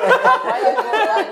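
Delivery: high, breaking laugh. Real, not acted. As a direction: laugh out the words in disbelief. Laughing at friend going to church.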